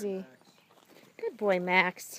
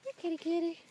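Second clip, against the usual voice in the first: calling a cat; 6 semitones higher, 8 dB quieter, much faster than usual. Real, not acted.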